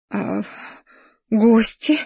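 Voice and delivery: hesitant, worried question; mildly agitated, halting; flustered. A narrator's reading. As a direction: break off, then ask anxiously. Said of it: "разволновалась она".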